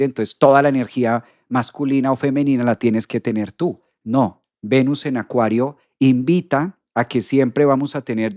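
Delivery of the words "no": ordinary delivery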